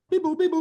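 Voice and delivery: high beep-boop dialing sounds; phone beeps. Acted. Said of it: "He dials the phone".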